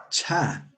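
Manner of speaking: "cha" released with audible breath; aspirated CHA. Shown as a demonstration. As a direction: say "cha" as if blowing. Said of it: "Como soplando".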